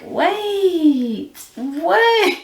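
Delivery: high, stunned double take; shocked wait wait. Retold or imitated. Retelling the pull-string light to viewers, high shocked disbelief. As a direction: stop short in disbelief.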